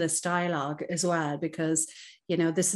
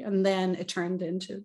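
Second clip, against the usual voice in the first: ordinary talk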